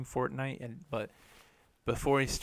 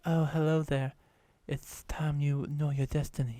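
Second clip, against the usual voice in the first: [name] voice; 3 semitones higher than usual. Retold or imitated. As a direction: imitate a streamer's put-on character voice. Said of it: "he does the voice".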